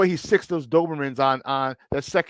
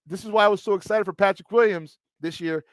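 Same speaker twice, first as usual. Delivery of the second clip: normal talking voice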